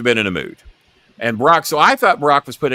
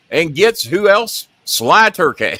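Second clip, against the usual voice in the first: usual speaking voice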